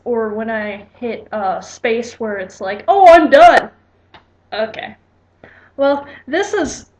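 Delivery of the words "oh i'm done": loud, higher-pitched burst of mock triumph; mock excited exclamation. Narrator acting out reaching the stopping point, loud mock excitement. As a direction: blurt it out loudly in pretend relief.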